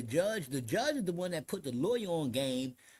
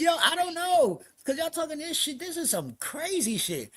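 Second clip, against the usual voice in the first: high, loud retold exclamation; loud, worked-up disbelief; bewildered outburst; 11 semitones higher, 7 dB louder than usual. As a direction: belt it out high, totally baffled.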